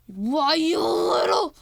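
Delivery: loud, slow strained shout; hammed-up angry threat; mock furious outburst. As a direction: drag it out in pretend rage. Sarah Connor angry in story.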